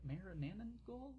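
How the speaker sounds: ordinary delivery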